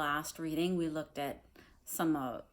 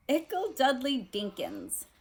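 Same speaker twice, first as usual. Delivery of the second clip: put-on higher, gushing baby talk; doting aunt; 9 semitones higher, 5 dB louder, slower than usual. Aunt Petunia cooing over her son in his uniform, gushing. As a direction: coo it like a proud aunt. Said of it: "said she couldn't believe".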